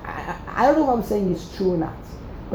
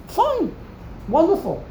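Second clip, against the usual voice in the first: mock fine; 8 semitones higher than usual. Real, not acted. Preacher conceding Yaakov's choice with irony.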